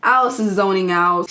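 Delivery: ordinary delivery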